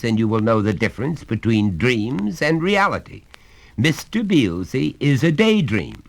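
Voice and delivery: stern father. Acted. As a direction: lay down the law to the boy. Father lectures.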